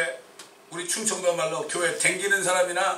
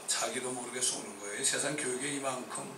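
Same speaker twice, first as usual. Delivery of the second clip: ordinary talk, nothing marked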